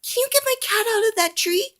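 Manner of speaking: put-on very high pleading child; little girl voice. A narrator's reading. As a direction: ask sweetly like a little kid. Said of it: "says a little girl".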